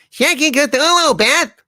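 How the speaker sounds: high, loud comic outburst; shouted exclamation. Acted. Comic character cries out to Beth, high shout.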